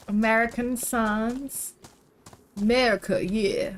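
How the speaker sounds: lightly hammed American, like a tourist joking; mock American accent tossed off for fun. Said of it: "That was my American accent".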